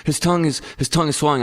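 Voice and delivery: high, insistent worried-mom retelling. Retold or imitated. Wife insisting the boy's tongue is swelling, worried. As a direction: imitate a concerned mother insisting.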